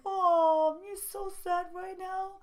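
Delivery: high, put-on pity voice; mocking mimicry. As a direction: coo it with fake sympathy, belittling.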